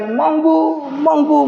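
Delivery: high, piercing call of the name; mother shrieking her daughter's name; heartbroken scream. A narrator's reading. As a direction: scream the name as she is taken away. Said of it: "ऐसी चीखें माँ जी ने पुनः निकाली".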